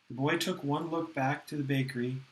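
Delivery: plain reading voice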